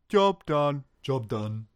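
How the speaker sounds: cartoonish orc worker voice; Warcraft peon. Peon answers an order to guard the cell, cartoon worker voice. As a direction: imitate a simple game-unit acknowledgement. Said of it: "one of the peons from Warcraft".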